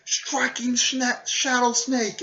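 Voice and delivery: high, drawn-out jutsu cry; attack call. Retold or imitated. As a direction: imitate an anime fighter calling a move. Acted attack call in fight.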